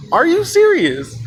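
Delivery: high, slow incredulous outburst; escalated disbelief. Retold or imitated. Embarrassed girl repeating her question, high, slow incredulous outburst. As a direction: stretch it out high in mock outrage.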